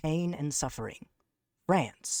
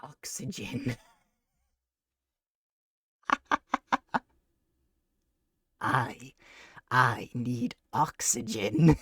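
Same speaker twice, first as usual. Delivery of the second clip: breathless and slow, gasping for air; light-headed from the voice, gasping between words; out of breath; 3 semitones lower, slower than usual. Real, not acted. Winded from doing the voice, he asks his viewers for oxygen, gasping and slow. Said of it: "My fingers and toes are tingly".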